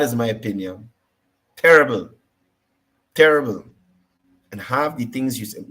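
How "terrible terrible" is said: emphatic loud. Real, not acted. Judging her delivery.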